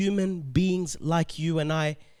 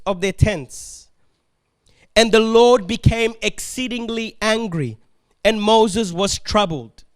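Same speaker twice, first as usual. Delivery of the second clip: raised, ringing Bible reading voice; scripture reading; 3 semitones higher, 3 dB louder than usual. Acted. Preacher reading Moses' complaint to the church, proclaimed aloud. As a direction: read the passage out like a preacher proclaiming. Reads Numbers passage aloud.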